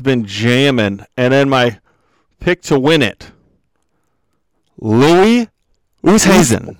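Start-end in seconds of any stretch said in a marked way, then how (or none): 4.8-6.8 loud name reveal in two parts; grand introduction of a champion; dramatic announcement. Real, not acted. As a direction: proclaim it with a pause between names. Dramatic reveal of his main pick.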